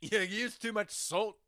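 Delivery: put-on lightly hammy Scottish accent, slow; a clumsy Scottish burr; bad Scottish accent. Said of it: "a terrible Scottish accent".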